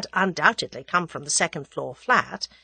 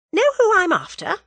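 bright, high, friendly question; genial; 12 semitones higher, 6 dB louder than usual. A narrator's reading. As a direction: ask it with sly cheer. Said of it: "she inquired genially".

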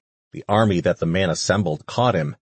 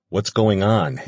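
usual speaking voice

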